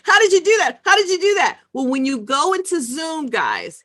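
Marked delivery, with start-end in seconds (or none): 0.0-1.6 much higher and louder, astonished asking; amazed viewer. Retold or imitated. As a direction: burst out in disbelief at the trick. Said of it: "Rakita's like".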